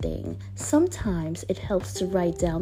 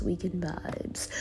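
whispering; 4 semitones lower, 9 dB quieter than usual. Acted. Whispered repeat of greeting.

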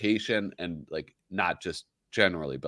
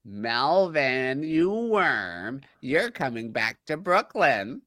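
wife voice; 6 semitones higher, slower than usual. Acted. Acting out wife character.

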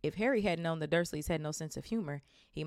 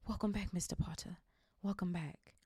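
tearful whisper; 2 semitones higher, 4 dB quieter than usual. Acted. Tearful old bartender greets Harry.

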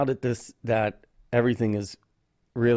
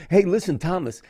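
excited greeting; 8 semitones higher, 5 dB louder than usual. Real, not acted. Loud greeting after laughing.

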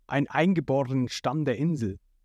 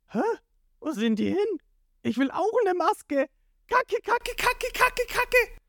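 very high, squealing panic; panicked. A narrator's reading. Ruffy without a gas mask, squealing panic. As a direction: squeal it in comic panic, climbing to the top. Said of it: "einem kurzen Moment voller Panik".

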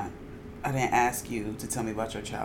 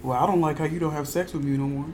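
mock boyfriend; 4 dB louder than usual. Mocking a man's complaint.

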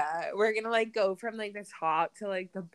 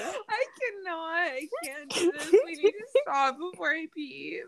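laughing hard; 8 semitones higher than usual. Real, not acted. Helpless laughter at friend's story.

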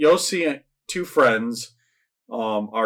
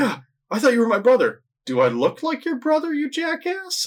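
high-pitched indignant squabble; hammed-up high, exasperated quarrel; mock bickering. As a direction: act out two guys arguing, voice way up. Acted movie argument in a retelling.

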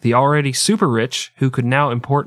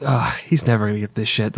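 usual speaking voice

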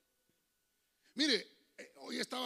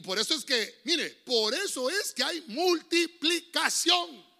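slow, louder, much higher declaration; preaching proclamation; 6 semitones higher, 10 dB louder, slower than usual. Real, not acted. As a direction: drive the point home from the pulpit. Preaching emphasis. (predicando alto y lento)

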